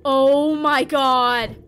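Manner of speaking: loud, panicked exclamations; terrified. Real, not acted. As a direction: cry out in alarm, urging speed. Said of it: "that's terrifying".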